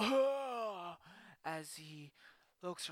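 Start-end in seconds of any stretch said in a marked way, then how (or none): 0.0-1.0 loud, higher sharp cry of pain. Acted. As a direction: yelp as you hit the ground. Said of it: "he lets out a".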